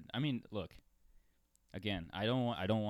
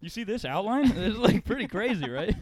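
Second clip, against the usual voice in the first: laughing disbelief; 13 semitones higher, 13 dB louder than usual. Real, not acted. Laughing over the sweat picture.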